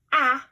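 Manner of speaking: "ah" as a loud, clear short a; short a sound. Shown as a demonstration. Teacher loudly models "ah" for listening game. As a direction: punch out "ah" so kids hear the vowel. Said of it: "listen to see if we hear the sound".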